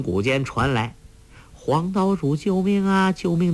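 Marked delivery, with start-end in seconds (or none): 1.5-3.5 higher, sustained, even calling tone; echo of a shout. A narrator's reading. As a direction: sound like a distant echo of a cry for help. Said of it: "的回声".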